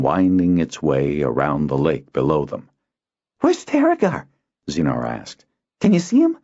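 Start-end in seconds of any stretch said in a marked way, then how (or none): 3.4-4.3 put-on a young boy searching the caravan; young boy Zinar. Boy on lookout asking his friend, high and eager. 5.8-6.4 put-on high eager boy's voice, louder; a young boy searching the caravan; young boy Zinar. Boy on lookout asking his friend, high and eager.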